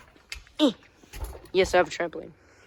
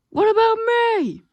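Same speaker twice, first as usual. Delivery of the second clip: high squeaky little train voice; squeaky whine; 7 dB louder than usual. Acted. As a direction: whine like a tiny engine. Second train whining.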